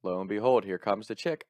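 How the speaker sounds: ordinary delivery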